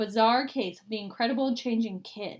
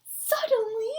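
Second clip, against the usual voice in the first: gasp of wonder; 12 semitones higher than usual. A narrator's reading.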